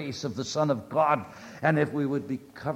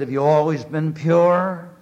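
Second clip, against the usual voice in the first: voice of judgment; 3 semitones higher, 9 dB louder than usual. A narrator's reading. Divine voice questions Curry.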